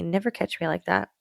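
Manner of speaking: ordinary talk, nothing marked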